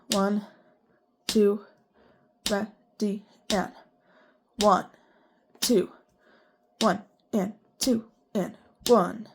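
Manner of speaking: strict even beats, every syllable the same length; robotic counting. Shown as a demonstration. Teacher counting sixteenths for the class, strictly robotic. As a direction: count like a metronome, no slack at all. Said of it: "I'm being very robotic in my rhythms".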